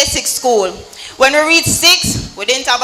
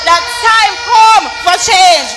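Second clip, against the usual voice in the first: rally speech; 5 semitones higher than usual. Real, not acted. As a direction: rouse the crowd with conviction. Fiery youth rally speech.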